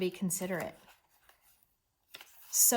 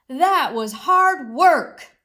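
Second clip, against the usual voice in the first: much louder and slow, emphatic; with emotion; 6 semitones higher, 13 dB louder, much slower than usual. Acted. Mole tells Mouse the work was hard, emphatic. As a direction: sound worn out but proud. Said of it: "I said it with emotion".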